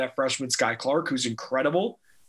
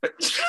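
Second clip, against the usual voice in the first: sneeze, then carry on; sneezing. Real, not acted. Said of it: "Bless you".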